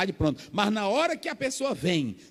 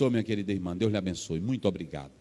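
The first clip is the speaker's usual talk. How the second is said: much lower, softer, grateful voice; soft, low, gracious thanks; gentle thanks; 14 semitones lower, 5 dB quieter, faster than usual. Retold or imitated. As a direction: thank her tenderly and humbly. Thanking a sincere sister gently.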